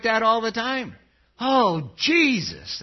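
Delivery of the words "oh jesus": louder exasperated oath; a mild mock yell. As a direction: imitate a cursing coworker. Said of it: "they'd yell".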